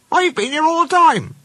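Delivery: very high little-engine voice; cheerful small engine. Acted. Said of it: "smiled the small engine".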